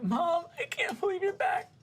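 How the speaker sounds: very high, squeaky mock crying; hammed-up weeping. Daughter reunited with her mother, high mock-weeping voice. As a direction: squeal it through pretend tears. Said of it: "is just weeping".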